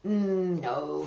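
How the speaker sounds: usual speaking voice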